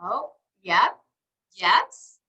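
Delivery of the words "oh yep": usual speaking voice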